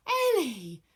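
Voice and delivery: loud, high call of the name; crying out. Acted. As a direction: call out to him, hoping. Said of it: "cried Aphrodite".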